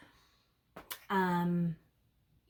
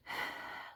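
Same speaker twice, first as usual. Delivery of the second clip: slow audible breath out; a calming sigh to show letting go; long exhale; 12 dB quieter than usual. Shown as a demonstration. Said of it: "Take a breath".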